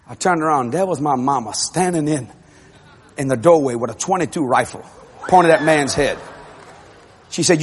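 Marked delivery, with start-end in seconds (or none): none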